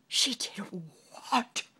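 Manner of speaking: loud disbelieving outburst; king cries out in shock; shocked cry. Acted. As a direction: explode in disbelief. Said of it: "cried the king".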